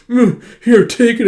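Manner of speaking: high, loud grudging offer; reluctant. Acted. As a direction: hand it over with a scrunched-up face. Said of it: "scrunching up his face".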